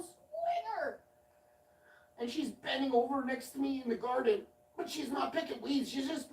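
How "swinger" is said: high sing-song calls of swinger; repeating swinger. Retold or imitated. Neighbor keeps repeating it to him, high sing-song. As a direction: keep chanting Swinger high. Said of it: "She just keeps going".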